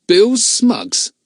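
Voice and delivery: put-on man jeering at the boy. A narrator's reading. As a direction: scoff at the name. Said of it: "with a jeering note in his voice".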